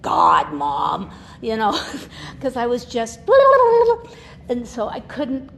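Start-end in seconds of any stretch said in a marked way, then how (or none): 0.0-1.1 put-on teen daughter exasperated. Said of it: "they'd be like". 3.2-4.4 sudden high loud laugh. Real, not acted. Laughing at her younger self, high laugh. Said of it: "laughing at herself".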